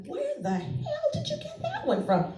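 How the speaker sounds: squeaky high, stunned question; laughing disbelief; shocked mom. Retold or imitated. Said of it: "I'm like".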